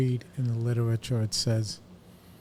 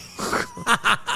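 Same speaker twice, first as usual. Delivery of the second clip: high burst of laughter. Real, not acted. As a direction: crack up at the picture. Laughs at the image.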